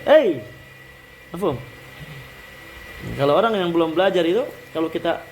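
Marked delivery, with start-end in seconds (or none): 0.1-0.4 loud, high "Hey"; calling hey. Shown as a demonstration. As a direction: call out hey to someone. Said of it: "bisa kita pakai".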